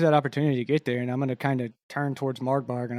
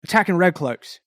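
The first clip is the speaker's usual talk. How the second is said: higher, louder acted fury; playing anger; 8 semitones higher, 6 dB louder than usual. Red cloak berating the attackers, furious and loud. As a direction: tear into them like an angry gang heavy. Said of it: "like, playing anger".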